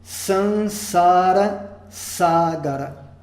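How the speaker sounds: Sansara Sagara drawn out syllable by syllable; slow Sanskrit demo. Shown as a demonstration. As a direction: say Sansara Sagara very slowly and clearly. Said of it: "Próximo exemplo".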